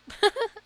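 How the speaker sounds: short high nervous giggle; a quick, jittery burst of laughter. Real, not acted. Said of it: "sometimes I laugh just 'cause I'm nervous".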